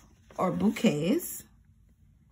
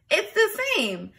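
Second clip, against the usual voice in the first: surprised; 12 semitones higher, 3 dB louder than usual. Shown as a demonstration. Teacher exaggerates.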